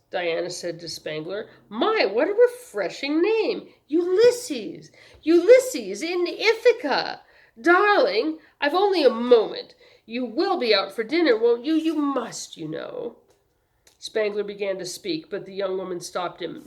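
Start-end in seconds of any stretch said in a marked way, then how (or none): 1.7-13.2 high, loud, affected delight; showy, insistent sweetheart; gushing socialite. A narrator's reading. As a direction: coo and gush, then press the invitation. Affected young woman gushing.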